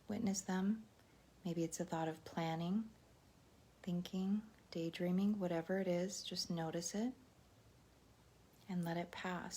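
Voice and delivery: soft, unhurried meditation voice; guided meditation. Real, not acted. Teacher settling live viewers into a silent minute, soft guided meditation. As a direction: ease them gently into noticing the breath, quietly.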